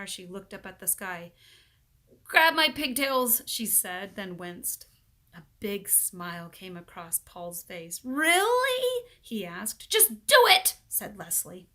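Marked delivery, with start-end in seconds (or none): 2.2-3.4 slow, loud firm call; resolute call. A narrator's reading. Leslie offers her pigtails, slow and loud. 8.0-9.0 very high, loud gleeful question; can't believe his luck; delighted really. A narrator's reading. Paul delightedly asks Leslie, very high and loud. 9.9-10.8 high, loud snap; just get it over with; impatient order. A narrator's reading. Leslie orders Paul, high and loud.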